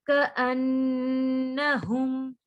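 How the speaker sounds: slow, drawn-out tajweed recitation; Quran recitation. Shown as a demonstration. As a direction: recite it with ghunna on the noon. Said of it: "मीम को हल्का सा सिर्फ हमने touch देना है". (धीमी तजवीद वाली तिलावत)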